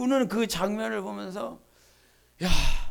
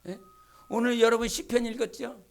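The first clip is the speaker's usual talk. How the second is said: ordinary talk, nothing marked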